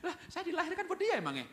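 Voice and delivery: very high, incredulous protest; indignant child. Acted. Child thinking about papa's demands, high indignant protest. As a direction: send the voice way up in mock indignation.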